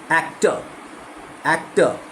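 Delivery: एक्टर ending in an uh, no r; actor without r. Shown as a demonstration. Teacher demonstrating the r rule again. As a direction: say एक्टर with the r dropped. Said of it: "ओ आर ओ एच बन गया".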